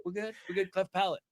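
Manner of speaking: high, soft backpedal; sheepish backing down. Retold or imitated. Host retelling how he backed down, high and soft. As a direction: meekly back off. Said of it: "I was like".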